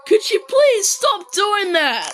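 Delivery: high, drawn-out pleading complaint; exasperated plea. Real, not acted. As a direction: beg them to stop, stretching each word.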